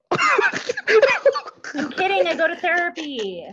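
laughing. Real, not acted. Laughing confession.

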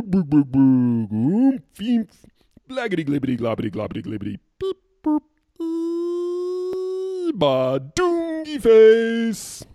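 high, loud, slow alien gibberish; gurgling tentacle alien. Acted. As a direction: ham up a wild gurgling alien babble. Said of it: "you wanna hear me do it?".

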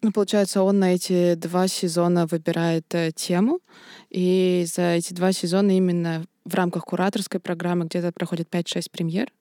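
ordinary delivery

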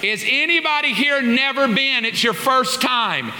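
high, bright call of a church usher. Retold or imitated. The usher calls down the steps to the waiting crowd. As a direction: sing out the good news to the line.

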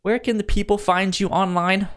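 usual speaking voice

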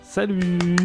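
ordinary talk, nothing marked

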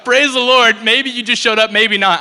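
giddy praise; laughing excitement. Retold or imitated. Friends reacting to the healing with laughing praise.

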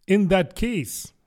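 high, slow, ceremonious opening; solemn. A narrator's reading. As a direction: begin solemnly, as if making a speech. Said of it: "said the dodo solemnly".

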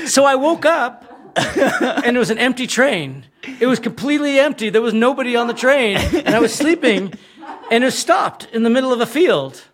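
high, laughing through the words; laughing while telling. Real, not acted. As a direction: tell it while cracking up. Telling crash story while laughing.

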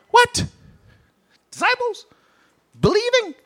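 squeaky high incredulous questions; high-pitched mock shock; mock disbelief. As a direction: act stunned in a high squeak. Preacher mocks disbelief.

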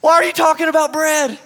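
high, loud, exasperated yell; hammed-up frustrated shout at clueless followers; losing it. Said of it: "Jesus begins to lose it".

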